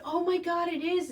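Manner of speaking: high, loud burst of retold amazement; surprised gasp. Retelling her reaction to the breeder's call, surprised and delighted. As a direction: squeal it like the news just landed. Hearing the kitten is male.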